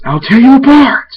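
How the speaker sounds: screamed high and loud threat; shrieking rage at a possessed friend; furious roar. Acted. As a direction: roar it out like you mean to kill.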